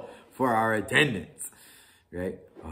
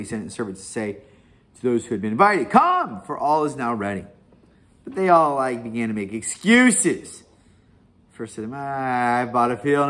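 lively, louder Bible reading with fast narration; scripture reading; 5 dB louder than usual. Acted. Preacher reading Luke fourteen aloud, animated reading. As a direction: read the parable aloud to the congregation.

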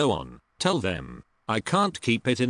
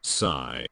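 a sigh said as a word, in posh voice; spoken sigh; 8 semitones lower, 3 dB quieter than usual. Acted. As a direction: say "sigh" with a weary sigh.

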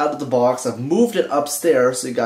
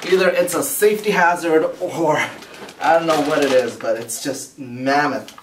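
normal talking voice

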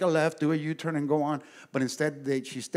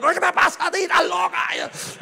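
high loud mocking yell at a driver; angry shout; 6 dB louder than usual. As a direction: shout it out the window. Yelling at other driver.

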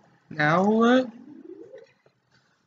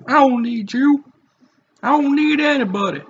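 higher, hammed-up lonely declaration; dramatic loner voice; 8 semitones higher, 4 dB louder than usual. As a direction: declare it like a brooding hero. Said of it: "I don't know why I'm talking like this".

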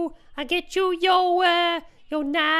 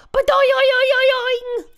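springy boing noise, higher and louder; boing sound; 6 dB louder than usual. Retold or imitated. [name] imitating the lizard's tail being pulled, springy boing noise. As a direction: imitate a tail twanging back. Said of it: "it used to go".

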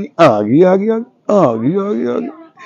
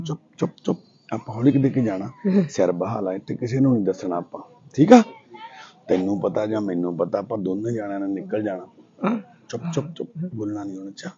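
much softer and lower, hushing her; hushed soothing; 8 semitones lower, 14 dB quieter than usual. Real, not acted. (ਹੌਲੀ ਹੌਲੀ ਚੁੱਪ ਕਰਾਉਂਦੀ ਘੁਸਰ-ਮੁਸਰ)

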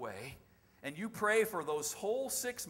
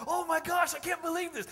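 high, loud gush of amazement, acted; all energetic; 11 semitones higher, 6 dB louder than usual. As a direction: gush like someone who can't believe their luck. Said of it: "he's just all energetic".

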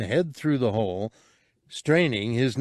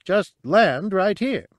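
high, louder urgent warning; urgent small creature voice; 9 semitones higher, 4 dB louder than usual. Acted. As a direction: warn him off in a high little voice. Cabe's lines.

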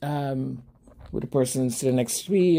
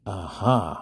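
knowing uh-huh; 7 semitones lower, slower than usual. Retold or imitated. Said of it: "And I went".

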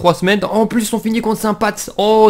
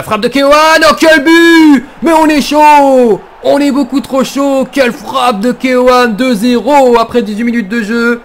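shouting, much higher, slow in places; excited sports commentary; 7 semitones higher, 7 dB louder than usual. Real, not acted. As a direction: scream the goal like a commentator. Goal celebration.

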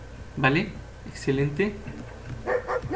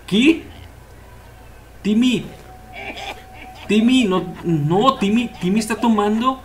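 alarmed; 5 semitones higher, slower than usual. Real, not acted. Shocked as kid drinks.

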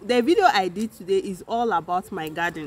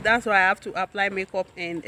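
ordinary talk

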